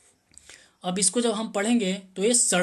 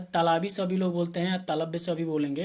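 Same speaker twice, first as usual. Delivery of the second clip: usual speaking voice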